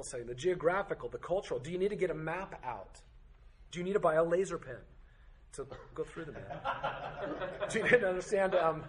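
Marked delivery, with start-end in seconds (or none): 5.5-8.9 laughing. Real, not acted. Joking about buying a laser pen.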